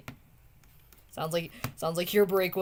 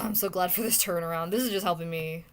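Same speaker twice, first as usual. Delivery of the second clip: ordinary talk, nothing marked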